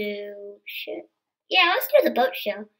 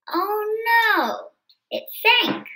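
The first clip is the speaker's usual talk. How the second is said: dismayed cry; 8 semitones higher, 4 dB louder, slower than usual. Acted. Boat sinks in the story.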